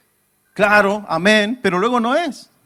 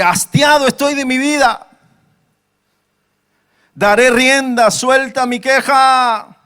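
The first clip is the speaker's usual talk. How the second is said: raised, high-pitched, drawn-out lament; anguished Job; 6 semitones higher, 4 dB louder than usual. Acted. Preacher reads Job's complaint to church, anguished outcry. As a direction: cry it out high, weary of life.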